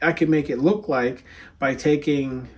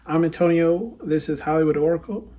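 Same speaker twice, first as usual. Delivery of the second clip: ordinary talk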